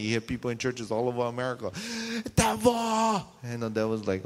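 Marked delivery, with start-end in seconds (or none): 2.3-3.2 high, drawn-out mock scream; mock church yelling. Preacher imitates people yelling in churches, mock scream. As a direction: imitate a worshipper screaming at the enemy. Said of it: "And we're screaming and yelling".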